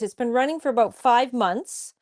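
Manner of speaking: normal talking voice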